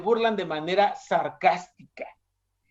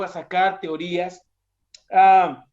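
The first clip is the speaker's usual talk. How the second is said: ordinary talk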